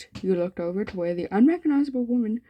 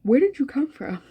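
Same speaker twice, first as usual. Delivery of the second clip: higher, louder mock-baffled question; mock bafflement; 7 semitones higher, 6 dB louder than usual. Reader asking imagined words where they came from, mock bafflement. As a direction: demand it in playful disbelief. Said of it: "and I'm like".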